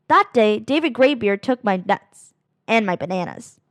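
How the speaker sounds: usual speaking voice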